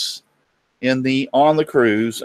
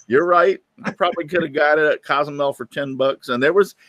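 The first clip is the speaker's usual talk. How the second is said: usual speaking voice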